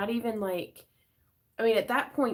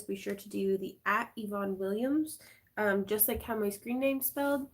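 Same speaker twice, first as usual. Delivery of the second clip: ordinary talk, nothing marked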